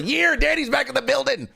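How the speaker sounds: excited, high-pitched welcome cry; hype shout-out. Real, not acted. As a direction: announce a regular's return like a hype man. Celebrates returning subscriber with hype call.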